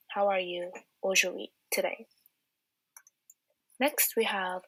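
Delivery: normal talking voice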